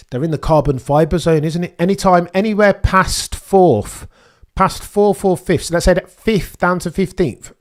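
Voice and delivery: getting carried away with the prediction; excited. Real, not acted. Excited prediction about crash zone.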